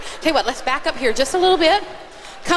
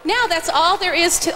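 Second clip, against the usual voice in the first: ordinary talk, nothing marked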